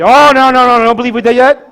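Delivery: loud, high string of protests, acted out; emphatic dismissal. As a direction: wave it away in a rush. Grandpa dismisses boy's answer.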